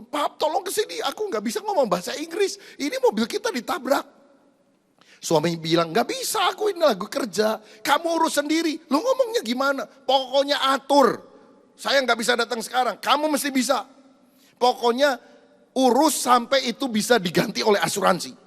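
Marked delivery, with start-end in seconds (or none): 0.0-4.0 high, pleading wife on the phone; wife calling her husband in a panic after the crash, high and pleading; panicked wife. Retold or imitated. 5.9-18.3 angry husband. Retold or imitated. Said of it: "Karena suaminya marah begitu".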